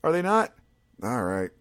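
high, whiny letdown; mock disappointed viewer. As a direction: sigh at Jason's bad timing. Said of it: "you're like".

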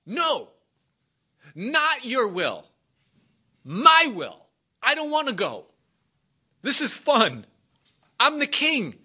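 high-pitched, protesting wail; hammed-up weepy refusal; tearful pleading. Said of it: "he wept like a bird".

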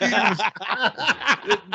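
"Parameters" drawn out slowly, high, through laughter; mock parameters. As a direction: stretch "Parameters" mockingly while laughing. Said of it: "he says parameters".

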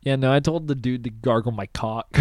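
ordinary talk, nothing marked

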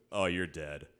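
low, dry pronouncement of doom; dropped-low, matter-of-fact aside; deadpan verdict. Retold or imitated. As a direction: imitate an onlooker's deadpan judgement. Brother-in-law's verdict on seeing the dog.

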